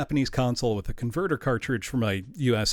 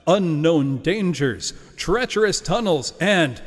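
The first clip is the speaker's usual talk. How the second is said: booming trailer narrator, louder and higher; movie announcer voice; 6 semitones higher, 4 dB louder, slower than usual. Acted. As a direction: sell it like a big movie trailer. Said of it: "I will use my best movie announcer voice here".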